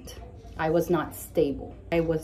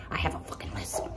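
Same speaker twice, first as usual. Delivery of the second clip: whisper; 9 dB quieter than usual. Real, not acted. Aside about her lisp.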